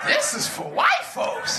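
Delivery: incredulous outburst. Retold or imitated. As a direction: blurt it out in disbelief. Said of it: "I was like".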